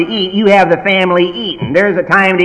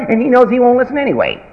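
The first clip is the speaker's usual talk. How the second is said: ordinary delivery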